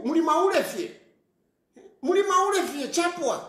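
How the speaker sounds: pitched well up, scolding hard; heated scolding. Real, not acted. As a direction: berate them, voice climbing high.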